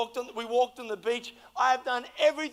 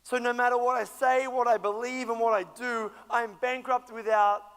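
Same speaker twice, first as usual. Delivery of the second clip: ordinary delivery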